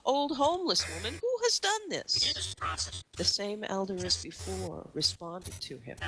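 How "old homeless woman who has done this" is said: high, rising disbelief; shocked outrage. Acted. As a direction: voice climbs in outrage. Young man outraged at change.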